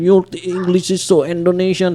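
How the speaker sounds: lightly mock a teacher's grumble; complaining professor. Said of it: "so he also complain".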